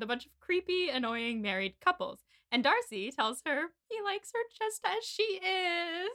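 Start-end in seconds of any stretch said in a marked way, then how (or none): 3.9-6.1 high, drawn-out swoon. Retold or imitated. Cohost retelling Darcy's confession to Bridget, swooning. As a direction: stretch it out sweetly, pitched up.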